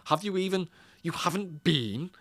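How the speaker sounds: retelling an American's mocking of a posh English accent. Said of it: "he's mocking Douglas Murray".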